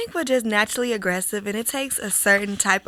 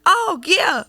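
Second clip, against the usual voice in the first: mock public agreement; 7 semitones higher, much slower than usual. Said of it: "in public I'm gonna be like".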